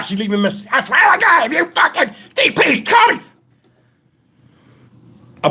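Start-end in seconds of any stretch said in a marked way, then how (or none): 0.7-3.2 high, shouty mock voicemail plea; mimicked frantic caller. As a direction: imitate her pleading hysterically down the phone. Acts out Tony's frantic voicemail.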